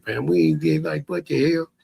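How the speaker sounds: usual speaking voice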